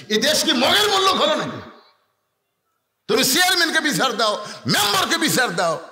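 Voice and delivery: wife's high-pitched outraged demand; acted indignant protest. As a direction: protest loudly, demanding justice. Acted wife demanding justice.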